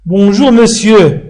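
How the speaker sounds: slow Bonjour, monsieur with monsieur as "mesjø"; careful model greeting. Shown as a demonstration. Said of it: "ولكن نقول".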